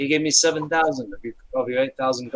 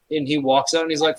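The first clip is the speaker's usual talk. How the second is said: ordinary talk, nothing marked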